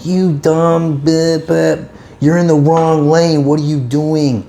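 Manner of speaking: irate driver. Retold or imitated. Irate driver berating a woman in the wrong lane, mock rant. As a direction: imitate a driver slowly berating someone, lightly hammed up. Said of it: "sticking his head out the window".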